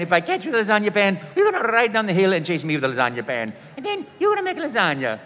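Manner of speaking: put-on cheerful young Italian plumber inviting kids to cook; Mario voice. Auditioning as Mario for the producer, high and joyful. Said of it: "I'll just do that nice voice".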